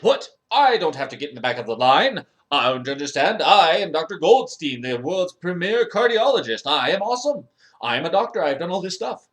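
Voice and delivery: loud, pompous protest; indignant outrage. Acted. Arrogant doctor refused entry at heaven's gate, loudly indignant. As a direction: demand entry as the great cardiologist.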